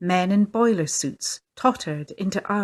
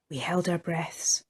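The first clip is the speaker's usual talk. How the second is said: hushed breathless whisper; 2 semitones lower, 8 dB quieter than usual. A narrator's reading. The children wait over the fallen sailor, hushed whisper. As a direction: whisper as if afraid to break the silence. Waiting to see if he rises.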